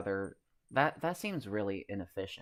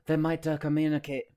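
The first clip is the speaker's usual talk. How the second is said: higher, louder mock Russian-Italian voice; Russian-Italian accent; 7 semitones higher, 8 dB louder than usual. Streamer retries soldier impression, higher, louder mock Russian-Italian accent. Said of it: "Russian plus Italian".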